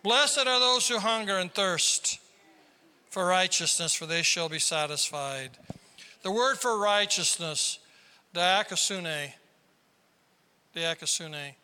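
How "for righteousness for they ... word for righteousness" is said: ordinary talk, nothing marked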